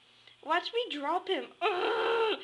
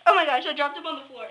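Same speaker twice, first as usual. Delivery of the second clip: loud, fast alarmed blurt; fast, louder frantic cry; panicked rush; 8 dB louder, faster than usual. Real, not acted. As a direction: rush the words out in horror. Fish dropped on floor.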